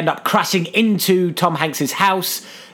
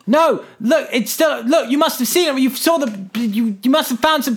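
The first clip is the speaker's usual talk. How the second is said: high, flustered mock insistence; hammed-up urgent pleading; insistent pleading; 7 semitones higher than usual. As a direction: act out someone desperately protesting. Character insists, pleading, in retelling.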